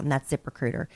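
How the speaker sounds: ordinary talk, nothing marked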